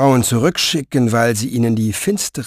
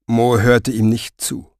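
ordinary delivery

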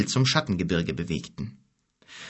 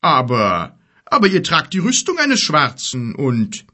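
giant voice; 4 semitones higher, 6 dB louder than usual. A narrator's reading. Giant speaks, louder and higher.

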